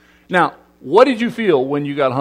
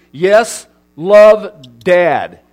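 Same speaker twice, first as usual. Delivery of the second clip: a hint of a proud dad signing his text, louder; old-fashioned dad sign-off; 8 dB louder, much slower than usual. Dad signing his text to the kids, mock formal. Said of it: "my generation adds things like this".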